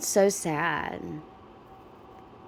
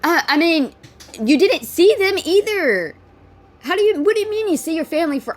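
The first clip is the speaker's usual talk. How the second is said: much higher and louder, calling nonsense; exasperated, pitched-up rant; incredulous; 9 semitones higher, 6 dB louder than usual. Real, not acted. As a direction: challenge her in disbelief. Disbelieving rebuttal continues.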